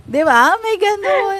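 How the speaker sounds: high and loud disbelief; high-pitched amazement; amazed disbelief. Real, not acted. Amazed at odd client requests.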